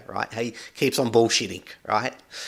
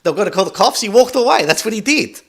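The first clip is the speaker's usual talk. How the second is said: excited outburst; 7 semitones higher, 6 dB louder than usual. Real, not acted. Mocking the neighbor's actions.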